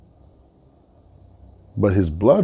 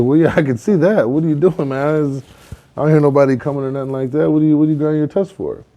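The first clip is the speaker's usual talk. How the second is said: higher, quick puzzled questioning; 6 semitones higher than usual. Acted. Fox asking a boar about his tusks, puzzled higher voice. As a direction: lightly play a nosy, baffled fox.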